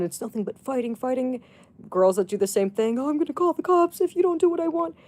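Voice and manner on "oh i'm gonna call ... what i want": high, fast, mocking girlfriend threat; mocking whiny girlfriend. Mocking a girlfriend who threatens to call the cops, high and fast. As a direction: sneer it like a spoiled girlfriend.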